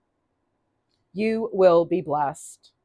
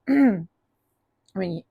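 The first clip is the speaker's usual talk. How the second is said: ordinary delivery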